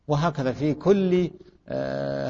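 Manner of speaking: ordinary delivery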